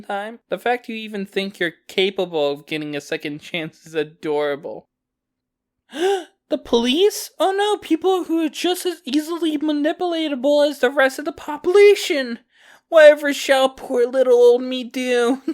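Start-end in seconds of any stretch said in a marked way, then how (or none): 5.9-15.4 high, louder hammed-up gasping alarm; mock panic. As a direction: fake being terrified, laughing through it. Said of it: "you seriously think I'd start freaking out".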